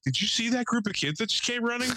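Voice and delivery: high-pitched wide-eyed question; kid playing innocent. Kid feigning innocence to the neighbor who caught him, high-pitched. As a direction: act like you had nothing to do with it. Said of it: "this is how he tried to play it".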